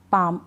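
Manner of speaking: पाम with a long vowel straight into M; isolated model word पाम, L left out; silent L. Shown as a demonstration. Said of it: "एल को pronounce नहीं कर रहे".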